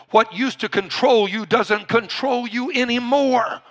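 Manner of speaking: ordinary talk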